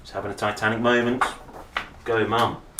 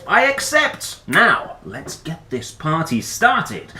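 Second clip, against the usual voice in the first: much higher, louder, regal and gleeful; grand party queen; 6 semitones higher, 5 dB louder, slower than usual. Acted. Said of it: "And Mum said".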